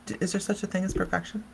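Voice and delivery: ordinary talk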